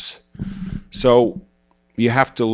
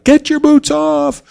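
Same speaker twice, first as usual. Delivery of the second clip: much higher, slow mock wife's order; nagging wife; slower than usual. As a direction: play the wife barking at the kids. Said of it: "And she'd be like".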